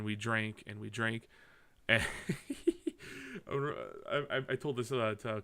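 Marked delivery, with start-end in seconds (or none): 2.1-3.4 high-pitched short giggle. Real, not acted. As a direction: let out a real high chuckle. Laughing about drinking that night.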